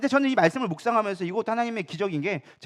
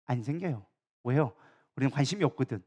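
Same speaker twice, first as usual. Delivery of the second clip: ordinary talk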